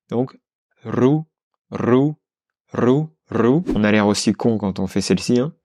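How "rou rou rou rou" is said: "rou" trilled at the palate, not the throat; rolled r. Shown as a demonstration. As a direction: roll the r in "rou". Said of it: "entre la langue et le palais".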